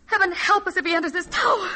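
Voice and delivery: high fearful cry; frightened. Acted. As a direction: panicky plea to heaven. Gong signals Maharaja approaching.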